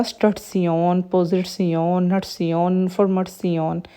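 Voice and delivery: word-list demo, नटसियोन with a ts and long o ending; "tion" as tsyon. Shown as a demonstration. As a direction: end स्टटसियोन, पोजिटसियोन in "tsyohn", not "shun". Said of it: "यहाँ पे सियोन की sound आएगी". (स्टटसियोन, नटसियोन में सियोन की sound)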